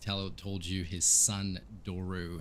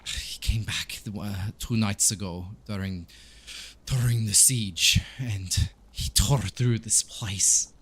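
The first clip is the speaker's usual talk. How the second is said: put-on accented priest recounting with grief; halting account, stressing each painful memory; troubled Donovich; 2 semitones higher than usual. Priest recounting the siege and his son's return, grieving. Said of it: "Donovich says".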